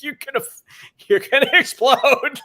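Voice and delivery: high, loud, laughing through a mock warning. Host teasing her guest about no sleep, laughing. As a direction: squeal it out between laughs. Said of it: "I'm like".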